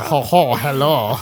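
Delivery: hammed-up fake French accent on a greeting; playing the fake French teacher with a put-on French accent; mock French accent. Teasing friend mimics a fake French teacher greeting, mock French accent. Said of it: "spoke with a French accent".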